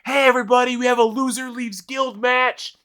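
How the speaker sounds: high, excited crowd hype; rally the crowd for the match; announcing a duel to bystanders in high excitement; hyped announcer call. Retold or imitated. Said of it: "And I was like".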